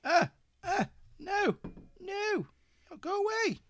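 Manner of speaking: very high, soft little protest; tiny high-voiced shooing; high-pitched fussing. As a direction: squeak softly at a misbehaving phone. Fussing at a touch-sensitive phone.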